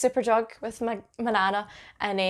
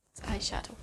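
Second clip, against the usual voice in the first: whispered; 11 dB quieter, faster than usual. Real, not acted. Muttered aside.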